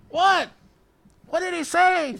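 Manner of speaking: imitated holler across a crowd; calling back. Student answering friend at the back, calling out.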